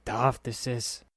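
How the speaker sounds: plain reading voice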